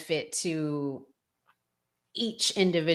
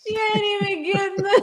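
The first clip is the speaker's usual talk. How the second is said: higher, louder words through laughter; laughing; 7 dB louder, faster than usual. Real, not acted. Host laughing about the wife's stinginess, high laughing voice. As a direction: squeal it while cracking up. Laughing while adding to the joke.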